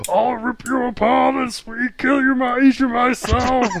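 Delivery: high, slow, loud demon voice; anime demon voice. Retold or imitated. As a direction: snarl it like an anime villain. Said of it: "none of the demons in that ever had a normal voice".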